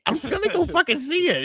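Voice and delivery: high-pitched indignant yell. Real, not acted. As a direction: repeat it, voice shooting up. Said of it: "Why are you yelling at me?".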